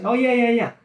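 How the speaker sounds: high "Oh", tumbling into repeated yeahs; sudden recognition. Retold or imitated. Coach imitating the seller's reply, sudden recognition. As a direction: imitate someone agreeing as it comes back to them.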